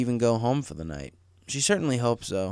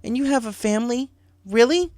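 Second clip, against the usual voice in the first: tearful anger; 11 semitones higher, 5 dB louder than usual. Acted. Rainbow confronting Marty at the door, tearful fury. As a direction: lash out through tears. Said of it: "tears pricking her eyes".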